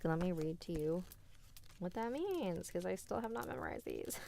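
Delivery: ordinary talk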